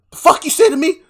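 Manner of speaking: high, loud furious yell as Roku; angry Roku. Retold or imitated. Roku yells at Aang for tattling, loud and high. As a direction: Roku roars at Aang, outraged. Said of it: "He's like".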